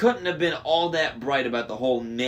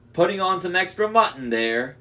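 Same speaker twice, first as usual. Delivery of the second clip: louder giant voice, mock scolding; teasing Cyclops; 6 semitones higher, 4 dB louder than usual. A narrator's reading. Cyclops jokes about Percy's sheep's weight, loud. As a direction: tease the fat sheep as a giant.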